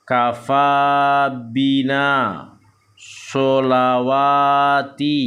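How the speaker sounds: slow, drawn-out Arabic syllables on an even tone; measured Quran-reading demonstration; Iqra recitation drill. Shown as a demonstration. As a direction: chant each syllable long and steady. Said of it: "kita akan lanjutkan membaca".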